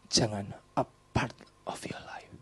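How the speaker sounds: breathy hushed aside; soft unvoiced hush; whisper. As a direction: whisper it like a secret.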